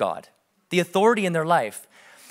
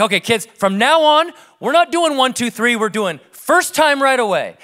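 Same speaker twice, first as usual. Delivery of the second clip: louder, higher voice laying down the rule; announcing dad; 5 semitones higher, 5 dB louder than usual. Retold or imitated. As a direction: proclaim it to the kids like a new house law. Dad announcing new family rule.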